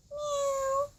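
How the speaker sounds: a high, thin kitten meow; playful high cat meow. Pretend. Baby cat voiced in play.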